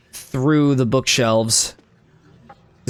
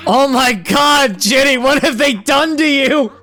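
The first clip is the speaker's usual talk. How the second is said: mock horror; 9 semitones higher, 3 dB louder than usual. Gamer cries out to Ginny in mock horror. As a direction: wail it with hammed-up dismay.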